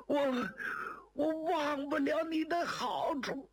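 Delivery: high, wailing plea through pain; acted crying. Wounded man begging to be finished off, tearful wailing. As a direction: wail and moan as you beg for release. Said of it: "哎，别哭，别哭，别哭".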